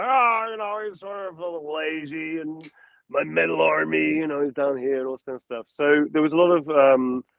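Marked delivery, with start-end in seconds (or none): 0.0-5.0 loose and lackadaisical like a smug slacker; Heisenberg voice. Shown as a demonstration. Showing the interviewer his villain's lazy rhythm, drawling. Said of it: "Whereas, uh, Heisenberg".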